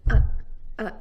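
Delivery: uh-uh with brief glottal catches; isolated glottal stop demo. Shown as a demonstration. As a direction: cut the air off sharply at the throat. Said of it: "a very brief closure of the vocal cords".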